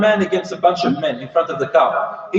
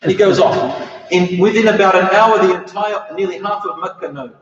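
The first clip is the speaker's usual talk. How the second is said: usual speaking voice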